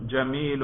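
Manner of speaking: جمیل pronounced "jamilun"; nominative -un ending. Shown as a demonstration. Teacher modelling the nominative for a student, final -un sounded. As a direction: finish جمیل with an audible -un. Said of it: "اس کے رفع پر ہم تلفظ کر رہے ہیں".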